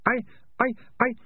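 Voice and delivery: high, halting stammer; stuttering. A narrator's reading. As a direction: stick on the word in a very high voice. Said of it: "the man stuttered".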